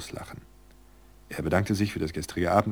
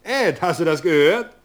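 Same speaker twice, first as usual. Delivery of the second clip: startled call; 11 semitones higher, 10 dB louder, slower than usual. Acted. Startled man calls out to Ed.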